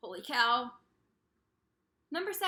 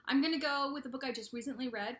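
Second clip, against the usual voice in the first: ordinary delivery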